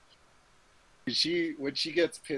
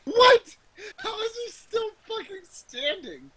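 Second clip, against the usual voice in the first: shocked outrage; 6 dB louder than usual. Real, not acted. Shocked the leader survives.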